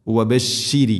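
وبشر with the sheen spread wide. Shown as a demonstration. Teacher demonstrating the spread ش in وبشر. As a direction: say وبشر spreading the ش sound broadly. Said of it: "ഷീൻ എന്ന അക്ഷരത്തെ പരത്തി ഉച്ചരിക്കണം".

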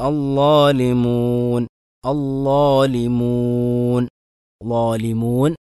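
slow drawn-out tajweed reading of അല്ലാലിമൂൻ; slow recitation. Shown as a demonstration. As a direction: recite slowly, stretching the vowels. Quran recitation demo.

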